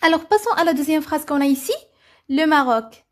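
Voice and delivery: normal talking voice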